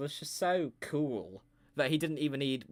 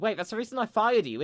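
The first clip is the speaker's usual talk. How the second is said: high, louder startled accusation; sudden realisation; 9 semitones higher, 6 dB louder than usual. Acted. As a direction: catch on all at once and call it out. Panda realises firing reason.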